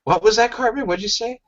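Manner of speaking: a mild mock teacher's challenge; hint of Mr. Garrison. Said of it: "reciting a scene from South Park".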